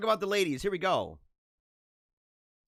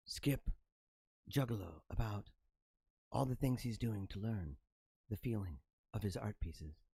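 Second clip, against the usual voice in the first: soft, low, mellow public radio host; mock the hushed radio announcer; parodying a softer, lower NPR presenter; 8 semitones lower, 9 dB quieter than usual. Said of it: "Stop talking like that".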